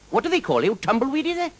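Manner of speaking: high, lilting Welsh dragon voice, friendly and teasing; a jolly Welsh dragon drawing out his words. Acted.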